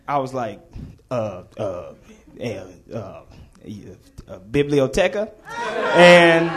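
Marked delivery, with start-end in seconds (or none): 1.1-5.3 halting, soft uhs into a questioning guess; stumped guessing. Retold or imitated. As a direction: fumble quietly for an answer you don't know. Stumped pupil guesses at an answer.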